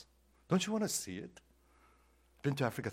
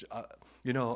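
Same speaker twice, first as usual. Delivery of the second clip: usual speaking voice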